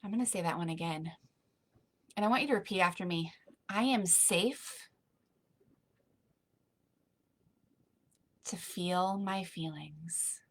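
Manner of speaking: soft, calm breathing guidance that fades toward a whisper; guided meditation. Acted.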